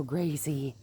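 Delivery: usual speaking voice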